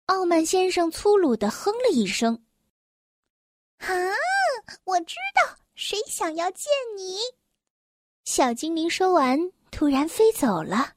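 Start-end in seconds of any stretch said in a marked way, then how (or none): 3.8-7.2 high, soft little elf; small elf voice, pitched up and hushed; tiny elf voice. A narrator's reading. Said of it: "细小的声音".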